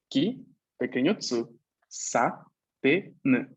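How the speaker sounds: ki, pequeño tsu, sa, te, n, separados con pausas; sílaba por sílaba. Shown as a demonstration. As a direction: deletrea por sílabas, despacio. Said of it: "una sílaba ki". (cada sílaba de ki, sa, te, n aislada)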